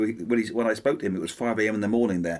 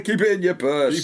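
high, louder broad Yorkshire; Yorkshire accent; 7 semitones higher, 4 dB louder than usual. Acted. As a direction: push the Yorkshire vowels. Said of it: "it has more power".

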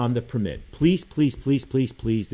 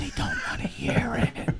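whispering like Biden; 11 semitones higher, 4 dB quieter than usual. Pretend. Host playing Biden in Ireland for a laugh, whispering. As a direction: whisper it theatrically, all breath and no voice. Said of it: "I'm whispering like Biden in Ireland now".

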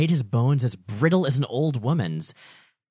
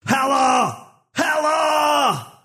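full-throated anguished roar, much higher; yelling; 6 dB louder, much slower than usual. Acted. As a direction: scream her name in rage, louder and higher. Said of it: "and yelling".

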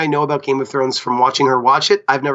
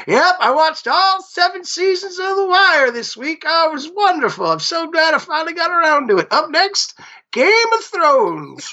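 higher, slow retiree voice; old man voice; 12 semitones higher, slower than usual. Retold or imitated. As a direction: play a pleased old retiree. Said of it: "Could you imagine being, like, sixty-five and being retired".

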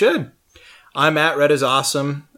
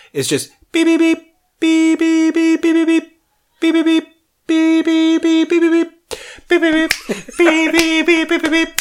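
high beeps spelling SOS; mouthed keypad beeps; 5 dB louder than usual. Shown as a demonstration. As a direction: tap out SOS with your voice. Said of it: "S O S is just".

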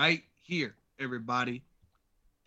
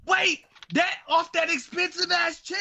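shocked shout; 9 semitones higher than usual. Real, not acted. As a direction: yell it in disbelief. Shocked about the pricey chair.

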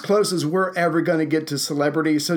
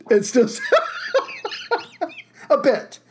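real laughter cutting off the words; high voice, collapsing into laughter mid-phrase; breaking up laughing; 11 semitones higher than usual. Real, not acted. Laughing about lingering sting.

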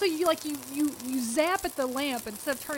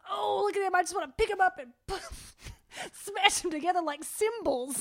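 higher-pitched mock gushing; gushing over cuteness; 7 semitones higher than usual. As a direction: coo over something unbearably cute. Acting out gushing over cute kittens.

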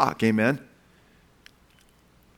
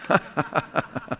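breathy chuckle; 5 dB quieter than usual. Real, not acted. Laughs at own lawyer joke.

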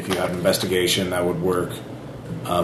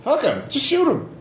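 much higher, dismissive voice; annoyed sniper. Acted. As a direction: bark it off callously.

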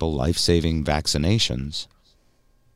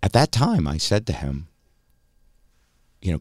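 ordinary delivery

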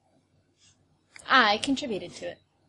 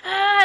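mock battle cry; 8 semitones higher, 6 dB louder than usual. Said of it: "ride by and be like".

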